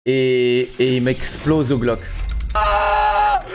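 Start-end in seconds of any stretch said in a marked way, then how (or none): 2.5-3.4 sudden high-pitched disbelieving cry; shocked disbelief. Real, not acted. Streamer yelping at his death in the game.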